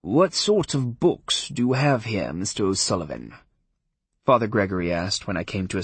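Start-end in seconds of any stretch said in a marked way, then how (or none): 0.0-3.3 a lightly voiced genial priest. A narrator's reading. Priest character voice.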